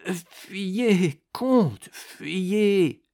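high, strained, straining plea; dying rasp. A narrator's reading. The fallen monk begs the count to flee, groaning. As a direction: force the warning out through pain. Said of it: "se souleva péniblement et râla".

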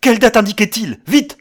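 high, loud frantic demand; almost screaming. A narrator's reading. Man demanding the date from Williams, near scream. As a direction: nearly scream the question, urgently. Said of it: "Il hurlait presque".

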